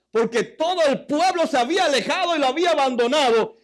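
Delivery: high, loud preaching; fiery sermon delivery; preaching at full force. Acted. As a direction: preach it out to the congregation.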